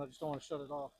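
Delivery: ordinary talk, nothing marked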